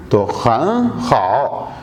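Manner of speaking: खन हाओ said slow and low, Mandarin tones; slow Chinese phrase. Shown as a demonstration. Teacher modelling खन हाओ for students, slow and low. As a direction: spell out खन हाओ slowly. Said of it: "खन हाओ तो बहुत अच्छा".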